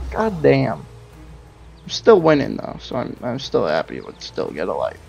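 normal talking voice

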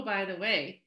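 ordinary delivery

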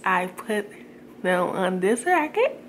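placing elastic on braces; fingers in mouth. Real, not acted. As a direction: lip pulled aside. Seen 0: fingers hook elastic onto braces, pulling lip corner aside.

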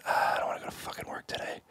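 groggy, very soft whisper; reluctant whisper. Retold or imitated. Waking at six for set, reluctant whisper. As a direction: whisper it with weary dread. Waking up dreading work, alone.

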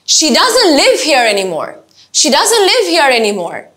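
She doesn't live here anymore, stressed on live, falling, slow; slow statement, stress live and let it drop; falling tune. Shown as a demonstration. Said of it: "প্রত্যেকটাকে তিনবার করে করে বলবো".

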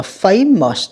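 "famous" slowly, stressed on FA; first-syllable stress. Shown as a demonstration. Teacher showing students word stress, FA-mous with the first syllable stressed. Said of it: "âm một nha các bạn".

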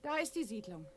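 soft, hushed voice while sneaking. Acted. Laura whispering to Jan near the village, hushed. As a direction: keep it down so the guards won't hear.

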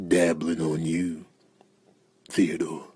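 low, hushed, slow purr; creepy seduction. Acted. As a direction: savour each phrase with hungry longing. Seductive aside to Theodore.

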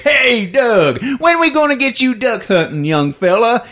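high, jolly small-town mayor, lightly voiced; a hint of a folksy mayor; hearty mayor. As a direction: greet him like a glad-handing politician. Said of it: "He's like".

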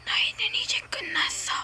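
menacing villain whisper; dark lord. Acted. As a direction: hiss the threat, breathy. Villain threatens the captured kids.

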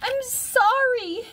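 put-on very high, drawn-out child's cry; crying out in apology. A narrator's reading. Harriet to her yelling mother, high childlike cry. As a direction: plead in a tiny high voice, stretched out. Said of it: "Harriet cried".

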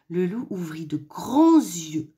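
ordinary delivery

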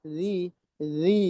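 রি with the tongue tip behind the upper teeth. Shown as a demonstration. Teacher explaining tongue placement to the class. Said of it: "জিহ্বার আগাটা উপরে দাঁতের নিচে লাগবে".

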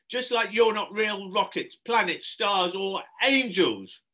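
high, loud, exasperated list; crying out. Acted. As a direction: snap at the children, stretching the end. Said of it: "cried Shelley".

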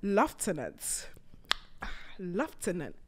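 Lieutenant said lef-TEN-ant, slow and low; teasing British Lieutenant, lef-ten-ant. Shown as a demonstration. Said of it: "Y'all heard a new word today?".

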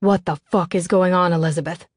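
usual speaking voice

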